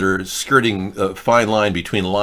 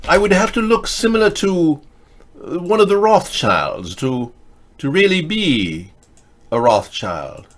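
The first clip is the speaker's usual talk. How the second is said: pompous character voice; 5 semitones higher than usual. Acted. Plays character denying accusation.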